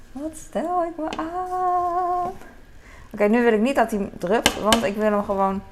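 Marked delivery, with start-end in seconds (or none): 0.1-2.3 "Wat stel ik me aan" drawn out slowly, high and soft; teasing herself, slow and higher; mock self-mocking drawl. Shown as a demonstration. Scolding herself for a kitchen fuss: slow high mock drawl. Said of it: "Oh, wat stel ik me aan".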